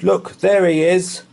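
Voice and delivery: loud, high accusing bellow. Acted. A voice catches the boy, bellowing loud. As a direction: roar it, pointing him out. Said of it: "a voice bellowed".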